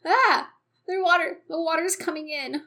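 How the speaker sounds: loud, high cry of alarm; panicked. Acted. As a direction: shout the danger as water floods in. Panicked cries as ship floods.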